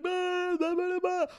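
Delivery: high, loud retching bah cries; howler monkey retching. Retold or imitated. Imitating her own loud vomiting to viewers. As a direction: bellow it like an old man trapped in a mine. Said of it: "that's how I sound when I throw up".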